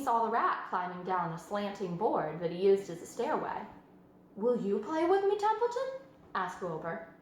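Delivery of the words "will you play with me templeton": high, rising plea to the rat; hopeful piglet. A narrator's reading. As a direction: ask the rat eagerly. Wilbur asks rat.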